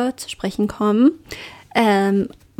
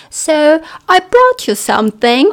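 put-on posh slow British accent, higher; 5 semitones higher, 5 dB louder, slower than usual. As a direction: play the proper Brit offering a gift. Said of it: "kommt bei mir so ein britischer Akzent durch".

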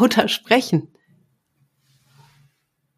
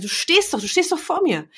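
higher, lightly teasing protest; teasing teacher; 9 semitones higher than usual. Retold or imitated. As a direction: playfully point it out. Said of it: "Ich sag".

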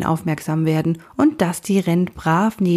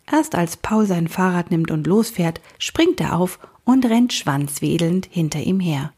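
ordinary delivery, nothing marked

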